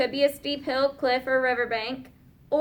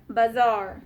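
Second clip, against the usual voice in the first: ordinary talk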